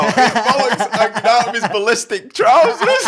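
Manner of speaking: high, loud, through helpless laughter; laughing punchline. Retold or imitated. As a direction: retell the customer's line while cracking up. Cracking up at own punchline.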